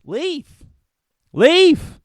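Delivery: high squeal, then a loud high yell; hammed-up high-pitched leave; mock shout to leave. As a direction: shriek the word leave at her.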